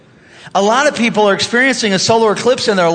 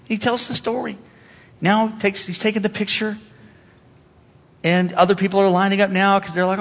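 usual speaking voice

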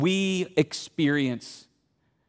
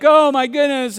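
higher, louder gush of emotion; moved gasp; 8 semitones higher, 7 dB louder than usual. Pretend. Moviegoer's heart reacting to the reunion, gushing loudly. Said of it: "your heart would be like".

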